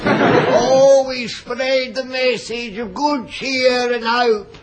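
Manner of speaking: mock sermon. Mock preacher sermon sketch.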